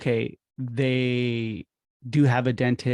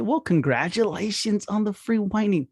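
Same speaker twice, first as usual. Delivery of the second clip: high, upbeat, celebratory; big congratulations; 7 semitones higher than usual. Shown as a demonstration. Receptionist congratulating the patient on the phone, upbeat. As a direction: make the freebie sound like a prize. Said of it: "Make it a big deal".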